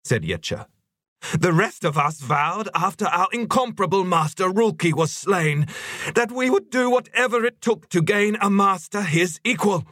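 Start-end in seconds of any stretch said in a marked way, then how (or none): 1.3-9.9 very high creature voice, sustained; fervent zealot. Acted. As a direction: argue rapturously in a piping high pitch. Yetcha's fervent argument to the circle.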